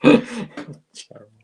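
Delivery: slow, laughing mock disapproval. Real, not acted. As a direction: laugh it out, drawn-out. Laughing at the beard.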